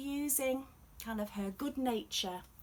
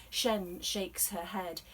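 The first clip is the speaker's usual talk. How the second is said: plain reading voice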